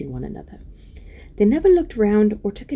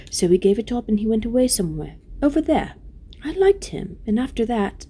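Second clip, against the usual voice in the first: higher-voiced young queen telling her story; tender-hearted Lucy, recounting; 5 semitones higher than usual. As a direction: tell it in Lucy's lifted voice.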